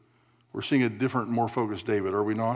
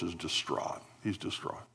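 soft, low, almost whispered "distraught"; hushed grief; 5 semitones lower, 10 dB quieter than usual. A narrator's reading. Preacher describing grieving David, softly hushed. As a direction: let the word fade into a hush.

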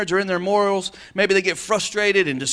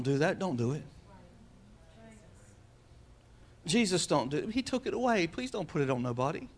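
softer, tender plea; soft pleading; 3 semitones lower, 7 dB quieter than usual. Real, not acted. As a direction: gently beg them to stop. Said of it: "pleads with congregation".

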